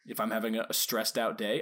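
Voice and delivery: ordinary talk, nothing marked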